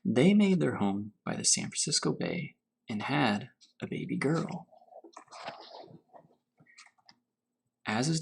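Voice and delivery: unhurried storybook reading to children, lightly voiced; story reading. A narrator's reading. Teacher reading Grandfather's Journey to her class, gentle storytelling. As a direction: read it gently, letting each image settle. Teacher reads picture book aloud.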